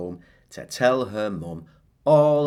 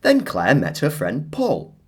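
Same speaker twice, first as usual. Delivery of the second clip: usual speaking voice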